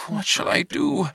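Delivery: a high, panicked alien cry for help; high, shaken otherworldly plea; frantic alien plea. Acted. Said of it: "starting to shake".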